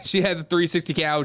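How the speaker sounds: usual speaking voice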